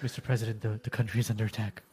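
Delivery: low hushed warning; urgent whisper. Retold or imitated. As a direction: whisper it into the president's ear. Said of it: "He's like".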